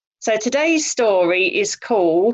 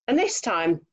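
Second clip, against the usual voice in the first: plain reading voice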